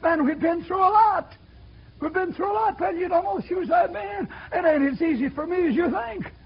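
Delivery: pleading for pity. Retold or imitated. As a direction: beg them to feel sorry for you. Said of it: "He's saying".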